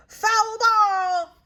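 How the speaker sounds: loud, high umpire's call; umpire yelling. A narrator's reading. Umpire calling the foul to the crowd, loud high yell. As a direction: bellow the call across the field. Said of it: "yells the umpire".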